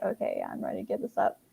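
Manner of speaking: breathless, whispered giving in, fast; weary surrender. Retold or imitated. After days of wallowing, finally giving it up in a whisper. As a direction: sigh it out, worn down. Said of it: "I'll be like".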